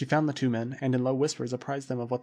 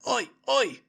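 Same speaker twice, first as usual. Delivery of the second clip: high, louder panicked cry; frightened cry; 11 semitones higher, 4 dB louder than usual. A narrator's reading. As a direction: yelp in fright. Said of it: "cried Bluber".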